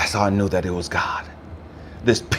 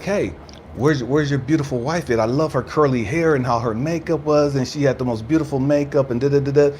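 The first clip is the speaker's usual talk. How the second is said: higher singsong voice of the store guy; high-pitched shop man; 4 semitones higher than usual. Retold or imitated. Store guy asking after the customer's wife, imitated in a higher voice. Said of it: "cringing 'cause of his voice".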